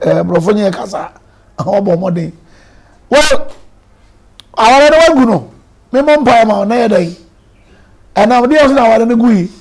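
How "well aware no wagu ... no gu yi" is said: amused mock retort. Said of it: "Wayɛ adeɛ?".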